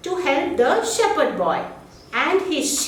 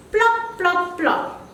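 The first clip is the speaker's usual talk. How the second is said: plopping sound; 5 semitones higher, much slower than usual. Acted. Crow drops stones into pot.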